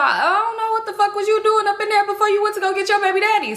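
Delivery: high-pitched, indignant comeback; defensive retort. Retold or imitated. Joe fires back at her about the baby daddy, mock-indignant.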